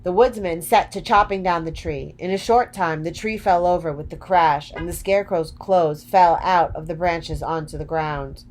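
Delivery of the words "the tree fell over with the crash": ordinary delivery, nothing marked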